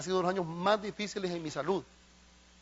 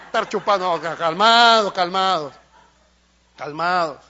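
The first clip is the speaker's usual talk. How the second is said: louder, laughing pulpit aside; loud joking preaching; 10 dB louder than usual. Acted. Preacher joking about wine to the congregation, loud and teasing. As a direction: tease the congregation loudly.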